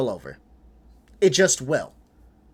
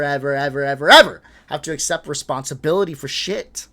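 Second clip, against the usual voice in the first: each "ever" stacked harder, building louder to "shit"; hammered repetition; 6 dB louder than usual. Real, not acted. Streamer rants at conservatives, each "ever" building louder. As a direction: pound every "ever" like a fist on the desk.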